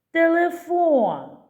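"Телефон" called high and slow, last syllable held long; calling the word. Shown as a demonstration. Teacher finding the stress, calling "Телефон" slowly with a long last syllable. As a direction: call out "Телефон", stretching the final FOON. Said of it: "Давайте погукаємо це слово".

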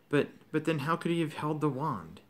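plain reading voice

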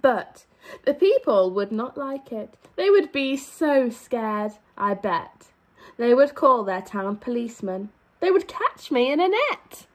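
lively, sing-song storybook read; animated picture-book reading; reading to children. A narrator's reading. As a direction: read it out to a class of little kids.

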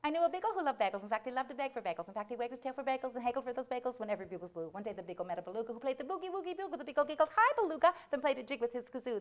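fast, bouncy patter, slowing for the last line; tongue-twister recital. Acted. Storyteller performing the beagle and beluga rhyme, rapid bouncy recital. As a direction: race through it in playful rhythm.

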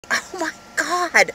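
slow, high-pitched "oh my God"; exaggerated creeped-out reaction; mock appalled. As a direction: draw it out high in retold disbelief. Retold reaction to creepy come-on.

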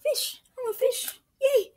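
squeaky, thrilled fish voice; high excited voice. Acted. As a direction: squeal with joy. Happy fish character.

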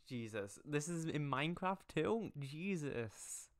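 high exasperated disbelief. Real, not acted. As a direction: groan at the absurdity. Exasperated reaction.

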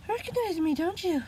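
high, sneering taunt with a smile; a villain gloating, pitched high. A narrator's reading. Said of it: "the man sneered with a smile".

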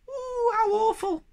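very high, drawn-out squeal; frightened wail. A narrator's reading. Sniff frightened by thunder.

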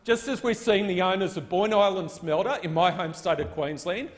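ordinary talk

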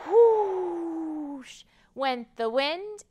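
slow, drawn-out whoosh; stretched whoosh. Acted. Librarian blowing the wind for the children.

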